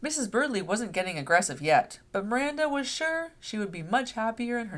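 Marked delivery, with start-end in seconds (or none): none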